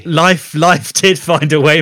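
slow, high, booming line; dramatic movie quote. Retold or imitated. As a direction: deliver it like a film trailer. Jurassic Park line quoted.

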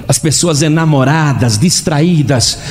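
ordinary delivery, nothing marked

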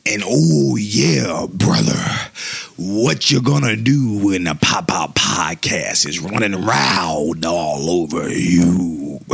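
gravelly hype-man intro, drawn out; wrestling promo. Pretend. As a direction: cut a promo like a pro wrestler.

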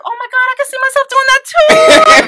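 high, loud gush of excitement; excited young self. Retold or imitated. Eighth grader answering grandmother about anchoring news, excited. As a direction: re-enact a thrilled squeal at a new dream. Retold childhood reaction to grandmother.

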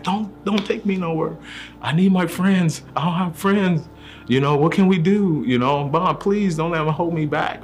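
put-on high, begging little-kid voice; pleading child voice. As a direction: beg like a scared kid who needs his friends. Pleading not to be held back.

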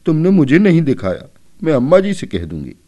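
hurt little sister threatening to tell mother; tearful little girl. A narrator's reading. Shyama, not shown the eggs, tearful and hurt. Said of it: "Shyama ने आंखों में आंसू भरकर कहा".

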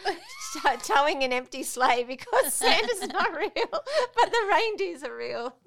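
laughing. Real, not acted. Mum laughing at her own reindeer logic, giggling. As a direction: giggle helplessly through it.

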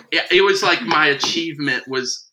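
ordinary talk, nothing marked

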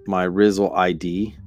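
normal talking voice

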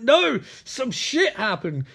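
high, drawn-out acted shout; mock angry retort. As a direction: shout back in exaggerated exasperation. Acted quarrel, Riker retorting.

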